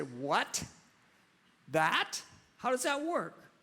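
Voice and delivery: baffled. Pretend. Listener baffled by God's ways.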